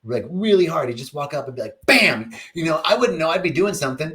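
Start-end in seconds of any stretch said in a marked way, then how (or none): 1.9-2.3 mock bam. Dad flicking his ear.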